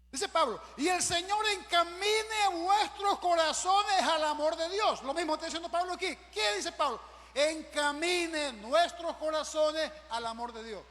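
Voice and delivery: loud, high declamation of scripture; full-force preaching. Acted. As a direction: preach it out, stretching the verse.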